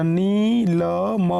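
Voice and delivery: नीलम slowly built up, ī held; sounded out in parts. Shown as a demonstration. Teacher showing how to read it, नीलम drawn out in pieces. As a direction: sound out नीलम part by part with a long ī. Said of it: "आप इसको कैसे पढ़ेंगे".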